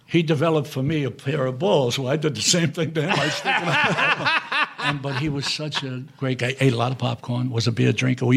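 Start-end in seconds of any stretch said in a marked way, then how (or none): 3.1-4.6 laughing. Real, not acted. Retelling prank on Newman, laughing.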